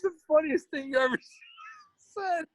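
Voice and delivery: giggling through the words; breaking up with laughter; laughing. Real, not acted. Laughing about the joke.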